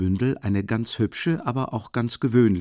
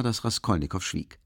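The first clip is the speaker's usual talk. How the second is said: ordinary delivery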